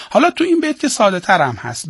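normal talking voice

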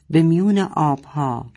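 ordinary delivery, nothing marked

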